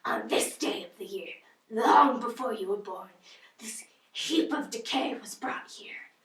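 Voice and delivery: put-on soft eerie whispering old lady; haunt them with bitter whispers; Miss Havisham. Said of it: "Miss Havisham".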